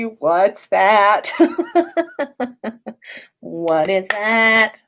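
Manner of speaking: usual speaking voice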